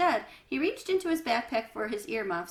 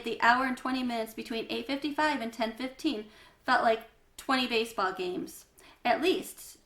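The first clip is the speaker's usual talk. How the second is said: ordinary delivery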